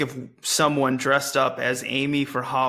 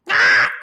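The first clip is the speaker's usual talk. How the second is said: loud low monkey shriek imitation; mock ape cry with a sudden burst; 10 semitones lower, 7 dB louder than usual. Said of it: "makes a monkey sound".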